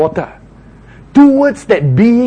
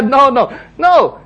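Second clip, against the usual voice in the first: usual speaking voice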